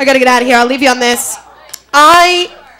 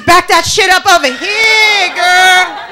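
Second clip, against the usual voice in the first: loud call-out; 5 semitones higher, 3 dB louder than usual. Acted. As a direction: holler it across, stretching "girl". Loud acted call-out opening the bit.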